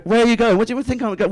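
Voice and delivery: high, whiny mock girlfriend voice; high nagging girlfriend. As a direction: pitch up to mimic her suspicion. Mimicking girlfriend's nagging question.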